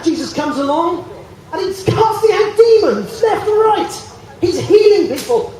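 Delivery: rising excited shout with a laugh; excited preaching. Real, not acted. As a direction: get carried away with joy.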